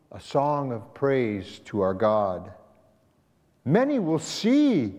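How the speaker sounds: usual speaking voice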